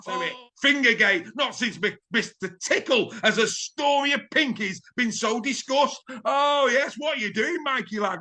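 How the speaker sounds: big rising sports-highlights commentary, a few shouts; excitable commentary. Acted. Presenter calling the highlights reel with hammed-up excitement. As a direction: sell every score like the match of the year.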